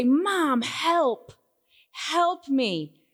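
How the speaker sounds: lightly voiced child asking for help; child pleading. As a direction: call out for Mom like a scared child.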